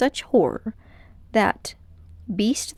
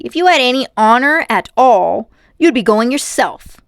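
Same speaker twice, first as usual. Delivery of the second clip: louder accusing; boy accusing his cowardly uncle, loud; angry boy; 3 semitones higher, 10 dB louder than usual. A narrator's reading. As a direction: throw it back at him.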